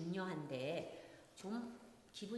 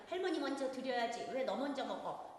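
put-on higher, louder reproachful scolding of a child; 6 semitones higher, 5 dB louder than usual. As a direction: tell the kid off sharply for eating first. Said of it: "이러면서 야단칩니다". (아이를 꾸짖는 높고 큰 야단)

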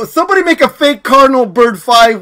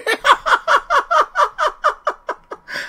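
long, high, softer laugh; crying with laughter; 6 dB quieter than usual. Real, not acted. Streamer fooled by a fake account, crying laughing. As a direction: laugh to tears, tailing off. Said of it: "I'm freaking crying over here".

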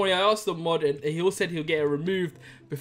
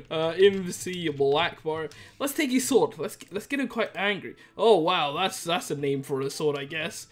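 ordinary talk

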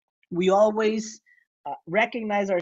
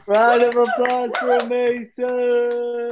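hype call; 4 semitones higher, 5 dB louder, slower than usual. Real, not acted. Host calls for applause.

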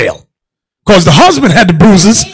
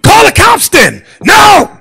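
mock shout; 13 semitones higher, 4 dB louder, slower than usual. Acted exchange with wife.

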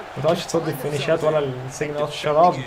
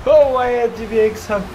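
announcer style; 3 semitones higher, slower than usual. Acted. Announcer-style win call.

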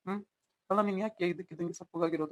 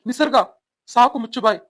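furious yell; 3 semitones higher, 11 dB louder than usual. Acted. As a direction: scream at her to shut up. Husband yells at wife.

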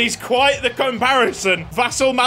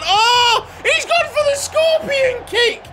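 excited yell. Real, not acted. Gamer seeing a scorpion kick attempt, excited shout. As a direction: yell as if you can't believe the audacity.

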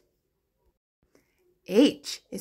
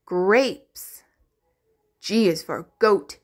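ordinary delivery

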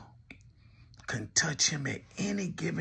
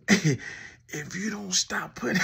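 slow, breaking into laughter mid-line; can barely get the words out for laughing; 6 semitones higher, 5 dB louder, slower than usual. Real, not acted. Roasting, cracking up mid-sentence.

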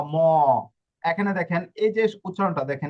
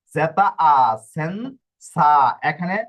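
slow, louder spelling; 6 dB louder, slower than usual. Shown as a demonstration. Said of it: "যা না হয়ে সা হলো কেন".

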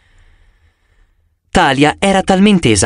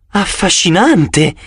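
slow, higher, marvelling; fascinated exclamation; 5 semitones higher, slower than usual. A narrator's reading. Professor marvelling at the pegasi, slow and higher with awe. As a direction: savour the word in wonder. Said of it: "esclamò".

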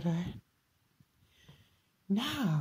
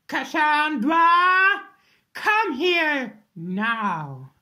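louder, slow, drawn-out summons; commanding call; 5 semitones higher, 7 dB louder, much slower than usual. Acted. Mother calling her daughter Cassandra, stern loud command. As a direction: drag out the name and order her over.

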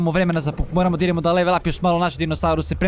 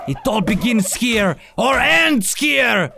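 slow epic line building to a shout; slow trailer boast rising to a shout; dramatic trailer voice; slower than usual. Pretend. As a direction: announce doom like a trailer.

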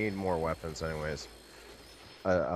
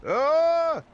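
loud high scream of pain; 11 dB louder than usual. Real, not acted. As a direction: yell out as you get hit. Hit by zombies, dying.

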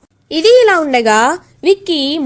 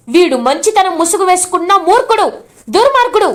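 raised, heated voice, slowing on the last insult; angry accusation; 5 semitones higher than usual. Acted. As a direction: spit out the insults at him. Brother accusing the sister's husband.

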